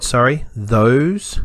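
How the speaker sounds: normal talking voice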